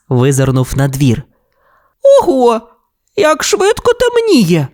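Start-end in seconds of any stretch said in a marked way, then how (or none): 2.2-4.7 very high, worried little voice; nervous. A narrator's reading. Toy dinosaur watches darkness fall, nervous high squeak. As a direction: squeak it anxiously, drawing out the end.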